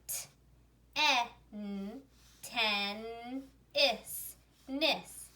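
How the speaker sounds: "T, e, n, ten, is, nis" in separate pieces with pauses; phonics-style blending of "ten" and "nis"; one syllable at a time. Shown as a demonstration. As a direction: sound out "T, e, n" then blend "ten". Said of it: "read one syllable at a time".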